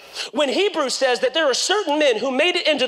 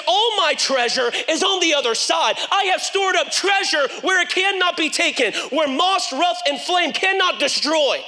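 fervent preaching shout; 7 semitones higher than usual. Real, not acted. Preacher building to climax.

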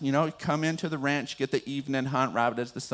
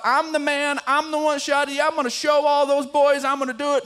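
imagined boasting; 11 semitones higher, 6 dB louder than usual. Retold or imitated.

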